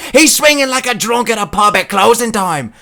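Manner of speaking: high-pitched British commentator shout; Michael Bisping impression. Comic reading out commentary lines, high British yelling impression. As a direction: call the fight at a high yell. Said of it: "Michael Bisping lines on commentary".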